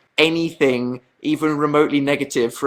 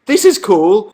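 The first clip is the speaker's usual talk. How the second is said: excited; 6 semitones higher, 6 dB louder, slower than usual. Retold or imitated. Retold teen excitement.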